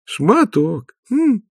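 musing, high-pitched drawl on the name; drawn out. Acted. Nanny considers the dog's name, drawn out and pondering. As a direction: stretch it out with a pondering hum. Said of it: "протянула нянюшка".

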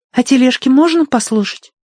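put-on high, loud child voice; little girl voice. A narrator's reading. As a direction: speak like a demanding little girl.

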